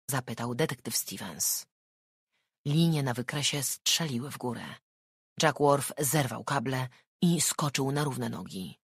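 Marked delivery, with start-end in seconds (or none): none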